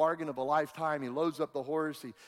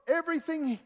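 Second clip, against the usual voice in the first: preaching at full force; 9 semitones higher, slower than usual. Acted.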